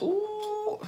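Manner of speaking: a pleased high coo of surprise; delighted ooh. Real, not acted. Finds product left in pan.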